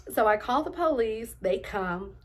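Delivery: normal talking voice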